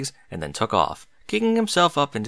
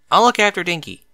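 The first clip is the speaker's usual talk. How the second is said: teasing; 7 semitones higher, 5 dB louder than usual. A narrator's reading. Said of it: "her tone almost teasing".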